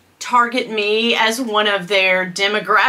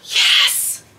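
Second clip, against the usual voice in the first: whispered yes. Retold or imitated. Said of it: "I was like".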